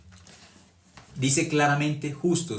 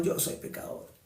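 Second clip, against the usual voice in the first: breathy whisper of the same confession; echoing the sinner in a whisper; whispered repeat; 10 dB quieter than usual. Retold or imitated. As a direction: let it fall to a whisper. Sinner repeats, voice drops away.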